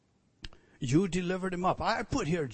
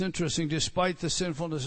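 normal talking voice

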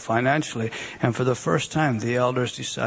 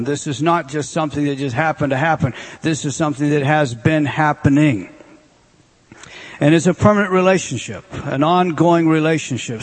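louder, emphatic sermon delivery; full-force preaching; 4 dB louder than usual. Acted. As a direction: preach it hard to the congregation. Preacher rising in intensity.

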